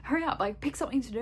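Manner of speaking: urging friends on holiday to get moving, impatient; frustrated urging. Retold or imitated. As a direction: hurry them along, fed up. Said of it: "I get really frustrated".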